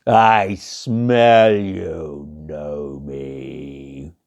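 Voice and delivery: slow low bear growl; menacing bear. Acted. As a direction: drag it out, low and threatening. Bear sniffs out gnome.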